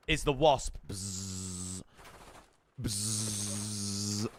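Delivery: slow, low buzzing like a wasp; wasp buzz imitation. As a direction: buzz through your lips like an insect.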